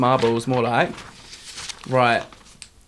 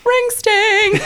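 very high squeal of ring sting; squealing mock pain. Joking about mint gel burning, high mock squeal. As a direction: shriek at the sting. Mocks painful mint lube.

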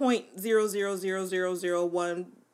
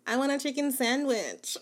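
lightly voiced whiny daughter; mock her asking for a sandwich; a hint of a pleading kid; daughter's voice; 5 semitones higher than usual. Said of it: "I don't know why I do my daughter's voice like that".

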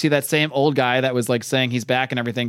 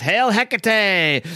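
high, very slow raven screech; hammed-up raven hailing; raven cry; 7 semitones higher, much slower than usual. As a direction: stretch it out like a shrieking bird. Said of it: "the raven says".

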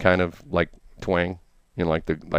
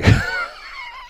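burst of laughter. Real, not acted. Host bursts out at guest's claim.

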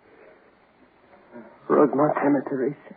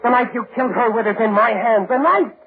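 much higher, frenzied threats; crazed fury; 9 semitones higher than usual. Acted. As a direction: rave as you strike. Madman attacks the dead man.

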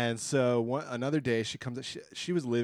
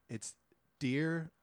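ordinary delivery

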